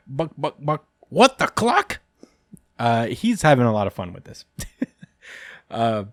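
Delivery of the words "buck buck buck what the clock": high squawky chicken voice, slowing on the pun; clucking chicken devil. Acted. Chicken devil Bucky hams up his egg pun, high and clucky. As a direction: cluck it out like a goofy chicken demon. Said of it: "Bucky goes".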